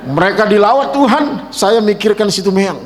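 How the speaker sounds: normal talking voice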